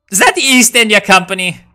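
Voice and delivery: sudden shocked high question; surprised. Real, not acted. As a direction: blurt it out in disbelief. Spotting a rival on map.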